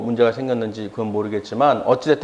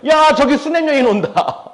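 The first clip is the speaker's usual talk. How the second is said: high, laughing, happy exclamation; delighted call; 11 semitones higher, 4 dB louder than usual. Retold or imitated. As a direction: spot a dear friend from afar, laughing. Elisha spots the woman, laughing. (반가운 사람을 보고 웃으며 외치는 목소리)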